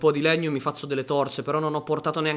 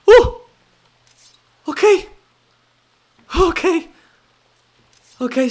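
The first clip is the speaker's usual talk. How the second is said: much higher, much louder frantic shouts; panicked shouting; 11 semitones higher, 10 dB louder than usual. Real, not acted. Gamer fleeing monsters toward the waterfall, high frantic yells. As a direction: yell as you flee for your life.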